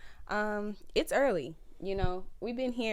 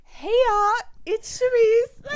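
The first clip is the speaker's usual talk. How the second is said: mock YouTuber voice, high and loud; Sharice; 14 dB louder than usual. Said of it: "very specific, like, YouTuber voice".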